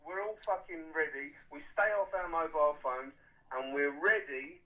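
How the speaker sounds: loud, slow, emphatic call to arms; rallying loud declaration. Real, not acted. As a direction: drive each word home like a battle cry. Caller rallying listeners for war.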